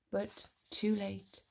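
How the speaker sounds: ordinary delivery, nothing marked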